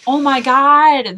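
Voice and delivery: loud, slow, high oh my God; fangirling over the short film; excited gush. Real, not acted. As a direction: burst out with delight. Gushing over Paperman short.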